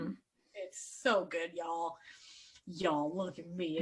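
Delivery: slow Southern drawl; southern twang. Pretend. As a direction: lay on a little Southern twang. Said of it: "I got my little southern twang on".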